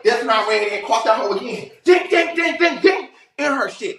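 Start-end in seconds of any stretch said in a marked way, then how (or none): 1.8-3.1 high shouted ding ding sound effects; punch sound effects. Retold or imitated. Telling friends about the fight, high bell-like shouts.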